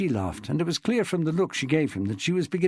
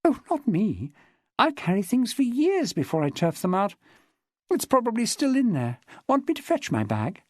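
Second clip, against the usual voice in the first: higher-pitched woman's dialogue voice; lightly voiced chatty woman witness; 10 semitones higher than usual. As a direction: lightly play a woman recounting her club life. Narrator voices Jessica in dialogue.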